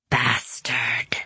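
low whispered hiss; hissing demon. A narrator's reading. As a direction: spit it out as a demon. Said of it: "hissed the demon".

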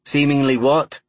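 rising Cockney question; curious Cockney prompt; Cockney accent. A narrator's reading. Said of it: "broad Cockney accent".

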